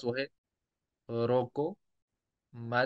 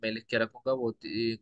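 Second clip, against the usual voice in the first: ordinary talk, nothing marked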